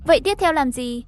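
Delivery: usual speaking voice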